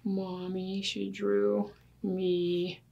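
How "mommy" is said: usual speaking voice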